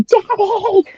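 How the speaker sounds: high-pitched retold scream; screaming for dad. Scared three-year-old on roller coaster screaming for dad, high mock scream. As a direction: cry out to dad on a terrifying ride. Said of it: "saying, screaming".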